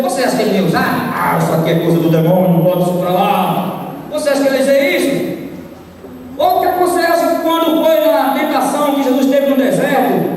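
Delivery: ordinary talk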